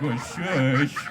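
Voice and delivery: loud, hissed mock shush. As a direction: shush it hard, far too loudly. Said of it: "how do you pee quietly?".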